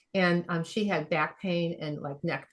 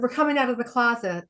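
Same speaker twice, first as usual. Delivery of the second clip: mock announcement; 7 semitones higher, 5 dB louder, faster than usual. Joking announcement to forty people.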